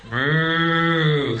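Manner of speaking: loud, lowing cow moo; mocking moo. Comedian herding the audience like cows, a loud mocking moo. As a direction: moo at them like cattle.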